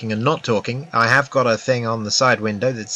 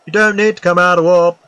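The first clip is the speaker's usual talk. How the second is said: mock computer voice, higher and louder; ship computer voice; 5 semitones higher, 5 dB louder than usual. Streamer mocking the ship computer, mock robotic voice. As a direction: imitate the nagging ship system. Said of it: "It's gonna say".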